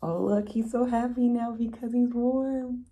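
ordinary delivery, nothing marked

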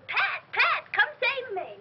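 high-pitched mocking cry for rescue; mock yelling for help. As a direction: mimic a helpless yell, sarcastically. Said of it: "Even if I hear you yelling".

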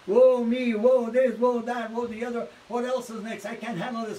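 high loud whine on woe me, fading away; mock the self-pitying complainer, starting loud and high. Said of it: "feeling sorry for themselves".

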